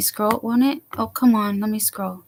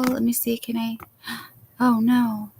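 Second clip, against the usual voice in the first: ordinary delivery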